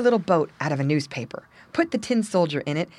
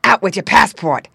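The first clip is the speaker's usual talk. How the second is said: loud barking rat; angry rat; 3 semitones higher, 11 dB louder than usual. A narrator's reading. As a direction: bark the order. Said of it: "asked the rat".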